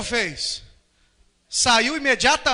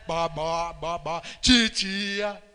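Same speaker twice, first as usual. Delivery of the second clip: lower, slow drawn-out syllables; mother coaching baby; 6 semitones lower, much slower than usual. Acted. Mother teaching the baby new words, slow coaxing. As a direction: stretch each syllable for a baby to copy. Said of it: "a mamãe ficar lá".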